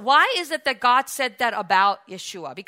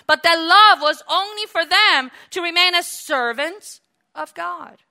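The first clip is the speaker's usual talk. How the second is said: fiery preaching; 7 semitones higher, 10 dB louder than usual. Real, not acted. Preaching at full force.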